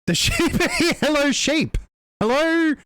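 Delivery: high-pitched, delighted hello with a laugh; excited greeting. Real, not acted. Streamer greeting a sheep raid, excited. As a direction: call out high to new arrivals.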